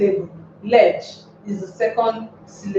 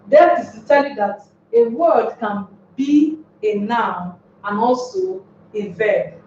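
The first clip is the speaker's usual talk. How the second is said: ordinary delivery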